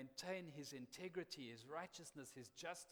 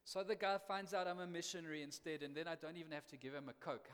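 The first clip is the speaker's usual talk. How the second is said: ordinary talk, nothing marked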